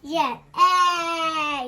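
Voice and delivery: A with mouth wide open. Shown as a demonstration. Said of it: "open your mouth and say A".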